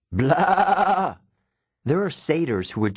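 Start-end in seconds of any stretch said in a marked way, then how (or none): 0.1-1.2 high nasal-free bleat, short and annoyed; irritated goat bleat. A narrator's reading.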